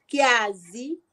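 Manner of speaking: normal talking voice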